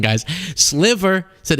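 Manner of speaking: ordinary talk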